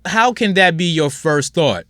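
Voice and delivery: ordinary talk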